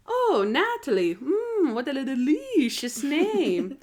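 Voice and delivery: slow, swooping theatrical vampire; vampire impression. Cafe vampire greets Natalie, vampire impression. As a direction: savour every drawn-out word like a vampire. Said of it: "it's impression time".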